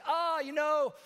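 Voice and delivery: high-pitched, dismissive brush-off; acted self-excusing "oh, you know"; breezy excuse. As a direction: shrug off the blame lightly. Acted excuse to an upset friend.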